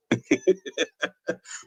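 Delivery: high, light chuckle; mocking laugh. Real, not acted. Streamer dismissing a goofy commenter, mocking laugh. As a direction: laugh him off.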